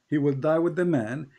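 ordinary delivery

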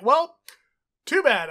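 high, loud mock retort; defiant high exclaim to the boys. Defying friends who won't come.

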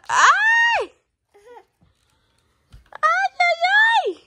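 very high squealed cries of delight; high, loud excited squeal. Real, not acted. Squealing at baby's haircut.

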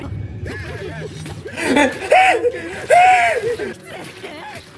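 long high shrieking laughter. Real, not acted. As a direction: lose it completely, a shrieking howl. Howling laugh at the scene.

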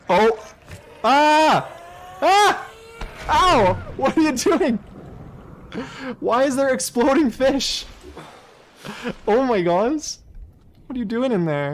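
high, loud panicked yelling; panicked screams. Real, not acted. Gamer shrieking at exploding fish underwater.

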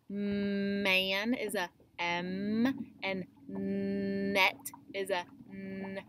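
slow, M and n drawn out clearly; contrasting sounds. Shown as a demonstration. Teacher to class, slowly contrasting two letter sounds. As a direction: stretch M and n so they differ. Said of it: "you hear the difference between m".

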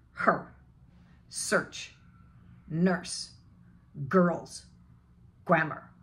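Her, search, nurse said short with curled R; short schwa R. Shown as a demonstration. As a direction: drill each word briefly and separately. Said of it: "really short".

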